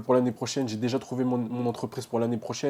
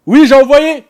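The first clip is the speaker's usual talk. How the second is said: much higher and louder, calling out; shouting back; 11 semitones higher, 18 dB louder, slower than usual. Real, not acted. Student shouts to his mother that he sent it, loud call. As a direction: yell it through the house.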